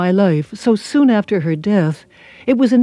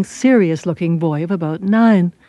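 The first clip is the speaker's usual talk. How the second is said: ordinary delivery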